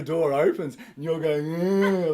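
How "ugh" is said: stupid face groan. Shown as a demonstration. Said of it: "like that".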